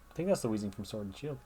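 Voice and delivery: ordinary talk, nothing marked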